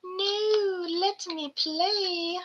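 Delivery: slow pleading pet voice; whiny gecko voice. Pretend. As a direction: voice the pet begging to play. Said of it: "He's like".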